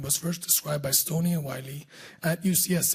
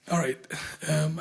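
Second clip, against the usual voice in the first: normal talking voice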